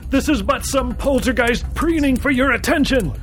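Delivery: desperate high-pitched outcry; frantic scream. A narrator's reading. Warning the natives about the false god, frantic high-pitched scream. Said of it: "I screamed frantically".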